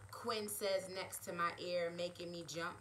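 usual speaking voice

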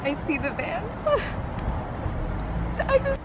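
high, tearful voice on the edge of laughing; real, overwhelmed with emotion. Real, not acted. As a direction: barely hold it together as the moment arrives. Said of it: "I'm literally so emotional".

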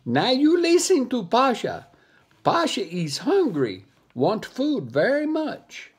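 high, commanding monkey voice; demanding monkey. Acted. Monkey ordering the dogs to get food, high and demanding.

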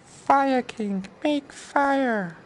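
ordinary delivery

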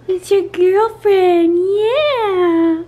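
high, loud, slow cooing. Real, not acted. Cooing to baby.

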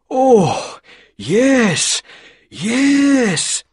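high, loud delighted yes; happy. Acted. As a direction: burst out gladly, relieved. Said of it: "said Mister Baker happily".

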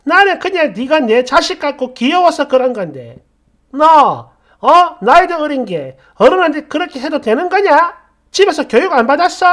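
high, loud, indignant telling-off; outraged elder, building to a fast jab; angry scolding. Acted. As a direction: scold a rude junior at full voice. Older woman scolds younger in row.

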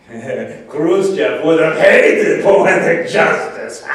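loud, drawn-out relish; gleeful gloating. Acted. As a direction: belt it out slowly with glee. Gloating joke before laughing.

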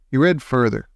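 usual speaking voice

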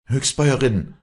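high, loud burst; acted agitated call; breathless outburst. A narrator's reading. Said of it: "stieß Afra atemlos hervor".

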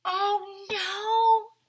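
high, squealed "oh no"; mock alarm. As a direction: gasp it out like a startled storyteller. Dramatic story exclamation.

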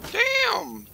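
high squeaky "Damn!"; surprised yelp. Real, not acted. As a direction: yelp it in shock. Sees huge iron haul.

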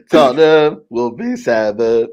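very slow mock-solemn declaration; drawn-out. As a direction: drag it out like a grand prophecy.

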